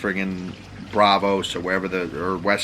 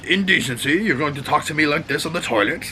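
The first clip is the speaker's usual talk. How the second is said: high, indignant scolding; outraged at being caught on the toilet; scolding father; 7 semitones higher than usual. Retold or imitated. Tywin scolding his son on the toilet, indignant.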